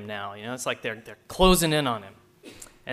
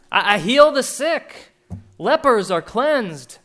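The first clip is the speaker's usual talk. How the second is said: loud, higher, urgent pulpit delivery; full-force preaching; 7 semitones higher, 8 dB louder than usual. Acted. Preacher voicing Jesus to the crowd, loud full-force preaching. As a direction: drive it home to the congregation.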